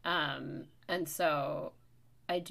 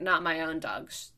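usual speaking voice

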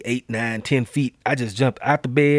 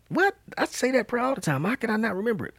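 disbelief; 10 semitones higher, 4 dB quieter than usual. Retold or imitated. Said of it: "I was like".

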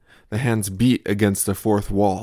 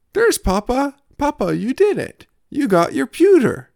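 put-on much higher, louder, gleeful toddler shouts; cheering toddler; 7 dB louder than usual. Toddler welcoming Papa home with his computer, high excited cheer.

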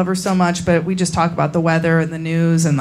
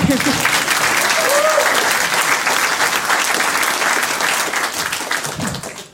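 high unvoiced laugh. Real, not acted. Laugh after joke.

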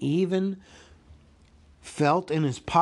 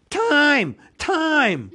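high, loud squealed cry; sudden squeal; 14 semitones higher, 8 dB louder than usual. A narrator's reading. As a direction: squeal it out in panic. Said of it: "with a sudden squeal was".

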